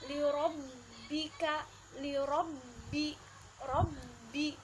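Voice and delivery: lirobbika with the bb pressed and held; pressed double b. Shown as a demonstration. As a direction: hold the bb in robbi. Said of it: "ba bertasydid harus ditekan dan ditahan".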